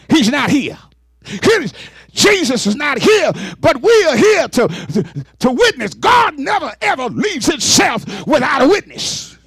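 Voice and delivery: shouted rising sermon climax; preaching at full force. Acted. Preacher rousing the congregation, shouting and rising. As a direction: build it into a shout.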